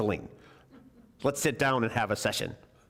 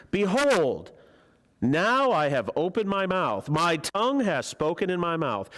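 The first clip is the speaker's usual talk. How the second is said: higher, louder, slow and grand proclamation; proud Elihu; 6 semitones higher, 3 dB louder, slower than usual. Acted. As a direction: boast like a young man sure he is right. Reads Elihu's boastful speech.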